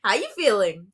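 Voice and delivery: loud, high drawn-out greeting; teasing pest. Acted. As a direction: sing out a big taunting hello. Poe greets in made-up story.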